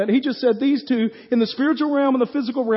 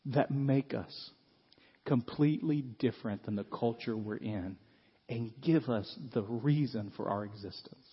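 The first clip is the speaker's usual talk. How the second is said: hushed earnest preaching; 9 semitones lower, 11 dB quieter than usual. Real, not acted. Solemn hushed preaching turn.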